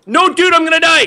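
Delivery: high, loud panicked yell; alarm shout. Real, not acted. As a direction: cry out as if about to die. Troll chasing him in game.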